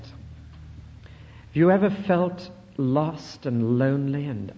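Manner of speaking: ordinary talk, nothing marked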